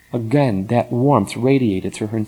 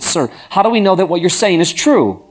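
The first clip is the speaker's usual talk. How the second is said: high, loud, urgent question; urgent plea; 7 semitones higher, 4 dB louder than usual. A narrator's reading. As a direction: she can't hold back her plea. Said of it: "couldn't control herself".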